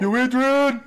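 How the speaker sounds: loud, high "Yo, Adrian" call; Rocky shout. Pretend. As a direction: holler it like Rocky. Rocky quote called out.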